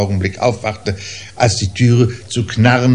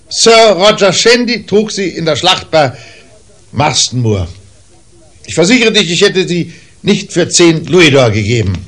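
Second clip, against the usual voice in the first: high, loud heated insistence; heated protest; 9 semitones higher, 6 dB louder than usual. A narrator's reading. Father to brother Toby about the heirloom boots: heated protest. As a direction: insist hotly on the boots' worth.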